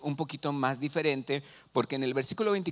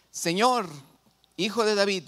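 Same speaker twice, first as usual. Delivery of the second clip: raised, higher-pitched plea read aloud; crying out; 5 semitones higher, 3 dB louder than usual. Retold or imitated. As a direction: call out as a woman pleading to the Lord. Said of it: "clamaba diciéndole".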